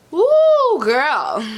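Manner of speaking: loud, high whoop of hype; excited cheer. Real, not acted. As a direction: hype her up with a big whoop. Cheering after proud answer.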